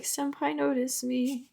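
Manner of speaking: high, soft, drawn-out mock plea; mildly sad pining. Cat pining for senpai's notice, mock-sad plea. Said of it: "it's sad".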